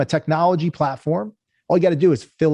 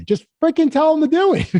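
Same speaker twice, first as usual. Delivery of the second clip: exasperated; 4 dB louder than usual. Retold or imitated. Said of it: "I was like".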